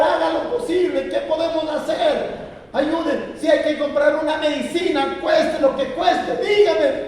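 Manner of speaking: high, loud, frantic begging; desperate pleading cry. Retold or imitated. Daughter begging the doctor to save her husband, frantic loud plea. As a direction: beg the doctor for your husband's life, voice straining. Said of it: "ella pegó un grito y dijo".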